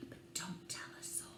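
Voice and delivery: fast, hushed secret whisper. Acted. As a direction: whisper it so nobody else hears. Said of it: "I'll whisper a secret".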